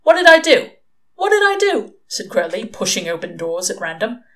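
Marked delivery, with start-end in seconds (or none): 0.0-1.9 loud, high-pitched outburst; incredulous, raised-voice self-defence; indignant protest. A narrator's reading. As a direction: protest your innocence loudly, voice shooting up. Crowley protests an accusation.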